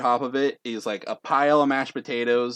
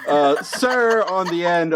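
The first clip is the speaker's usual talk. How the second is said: loud, high announcer call; raised public-address voice; 6 semitones higher, 5 dB louder, slower than usual. Acted. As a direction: hail someone down the line. Announcer calls out to a contestant.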